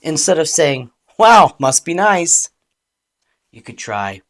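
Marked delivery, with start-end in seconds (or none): 1.2-2.5 loud, high mock admiration; pointed, resentful pretend praise; sarcastic. Shown as a demonstration. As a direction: jab with fake wonder. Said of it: "Clean communication removes the disguise".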